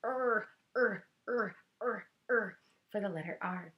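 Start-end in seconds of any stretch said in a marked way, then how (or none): 0.0-2.6 growling er er in a tug of war; dog growl. Shown as a demonstration. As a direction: growl like a dog gripping a toy. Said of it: "he sounds like".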